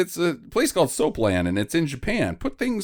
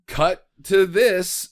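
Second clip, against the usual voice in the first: ordinary delivery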